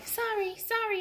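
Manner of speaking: high yelled apology; apologetic yell. A narrator's reading. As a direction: call out sorry as you barrel past. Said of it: "yelled Jack".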